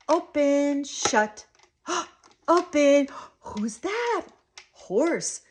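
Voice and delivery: put-on high, loud single words for a toddler; playful, suspenseful reveal; excited modelling. Shown as a demonstration. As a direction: gasp with wonder and lilt each word. Models play words to child.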